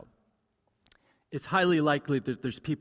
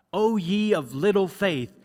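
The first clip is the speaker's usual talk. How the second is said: loud, drawn-out mock scolding; mock rebuke; 3 semitones higher, 5 dB louder than usual. Preacher imagines Jesus hammering doubting John, in mock rebuke. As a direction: hammer it slowly like a stern rebuke.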